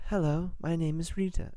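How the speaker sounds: Rita's plain Nathan accent, mildly voiced; lightly put-on Nathan lady voice; general Nathan woman accent. Shown as a demonstration. GM tests an accent option for Rita, lightly Nathan. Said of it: "general Nathan woman accent".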